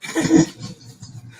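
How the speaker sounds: high, unvoiced burst of laughing; loud laughter. Real, not acted. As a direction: cackle out loud.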